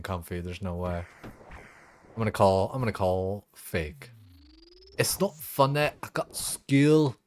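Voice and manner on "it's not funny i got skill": high slow put-on kid voice; quoting viral video. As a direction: mimic the video kid, drawn out. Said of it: "I love that fucking video".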